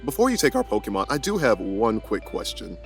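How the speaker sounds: ordinary delivery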